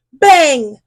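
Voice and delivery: ordinary delivery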